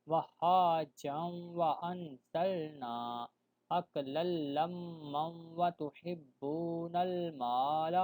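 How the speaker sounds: وہاجا وانزلنا recited slowly and run together without a stop; slow chant joining وہاجا into وانزلنا; joined recitation. Shown as a demonstration. Teacher showing students how to join verses, slow chant. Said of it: "اس کو اس کے ساتھ ملا دیں گے".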